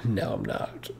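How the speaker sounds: dropped low and soft, under the breath; muttered aside. Real, not acted. To himself after lying to the boss, low soft aside. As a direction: admit it quietly to yourself.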